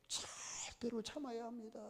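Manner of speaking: whispered, intense admonition; grave whispered command; hushed warning. Real, not acted. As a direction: drop to a whisper for emphasis. Preacher drops to hush for emphasis.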